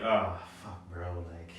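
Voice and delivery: low, soft, apologetic reenactment; retold apology. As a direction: replay a sheepish, subdued apology. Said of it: "in the most apologetic way".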